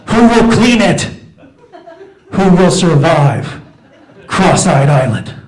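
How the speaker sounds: loud, slow, booming trailer-announcer voice; mock TV promo announcer. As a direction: hype it up like a reality show teaser. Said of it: "I just think, like".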